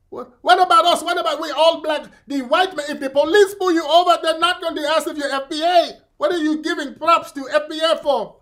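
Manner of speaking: put-on high, indignant Nigerian accent; hammed-up West African protest, pitched high; mock Nigerian accent. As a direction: argue in an exaggerated Nigerian accent. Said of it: "Mister Nigerian".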